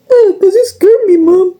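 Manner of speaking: high, exaggerated mock-crying whine; mocking crybaby imitation. Mom answering herself as her daughter, high mock-crying whine. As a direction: wail it like a teased crybaby.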